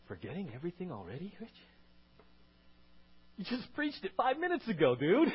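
rising loud self-scolding; self rebuke. Retold or imitated. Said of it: "I have to go".

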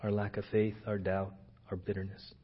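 hushed, earnest prayer voice. Acted. Pastor praying quietly for his church, earnest and hushed. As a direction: pray softly over the congregation.

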